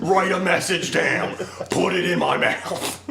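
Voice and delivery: slow mock scream. Comic yelling at baffled miners, slow and high. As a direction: bellow it slowly at a confused crowd. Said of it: "And you're like".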